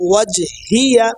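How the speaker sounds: ওয়াজহিয়া in careful Arabic, syllable by syllable; ওয়াজহিয়া as a measured recitation; correct pronunciation. Shown as a demonstration. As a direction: model ওয়াজহিয়া clearly for learners. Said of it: "উচ্চারণগুলো সহি হয়".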